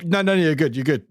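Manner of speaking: ordinary delivery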